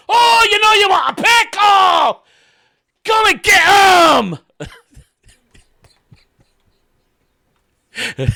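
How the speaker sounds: screamed, high Scottish pickle-seller's call; loud mock-Scottish hawker shout; Scottish accent. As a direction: holler at passersby in a Scottish brogue. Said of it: "there's this guy using that accent".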